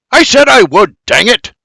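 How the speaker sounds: high, loud exasperated outburst; barked. A narrator's reading. Man in the wagon barks at the sky, exasperated. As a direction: bark it at the heavens. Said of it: "B B barks at the sky".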